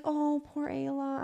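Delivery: soft, high, drawn-out mock sympathy; cooing pity. Partner mimicking a mother pitying her child, cooing. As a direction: imitate a fussing parent, slow and quiet.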